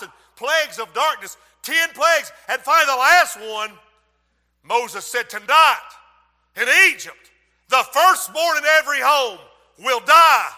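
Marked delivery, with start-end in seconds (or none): 5.3-10.5 loud grave proclamation; solemn warning. Acted. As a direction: announce doom to all of Egypt. Moses announces the last plague.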